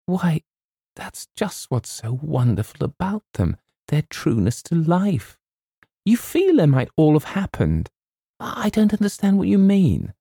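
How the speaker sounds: put-on high-pitched girlish indignation; girl's protest. A narrator's reading. Girl defends the books against James, in earnest protest.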